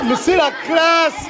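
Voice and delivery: drawn-out, high sarcastic praise; mock admiration. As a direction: oversell it slowly, loudly. Mocking friend's TN sneakers. (ironie moqueuse appuyée)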